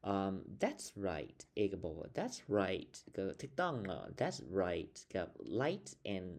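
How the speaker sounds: ordinary talk, nothing marked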